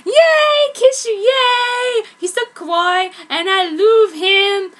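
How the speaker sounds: high, loud, slow squealing yay; squealing fangirl. Acted. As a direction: gush over a crush. Author squealing over crush.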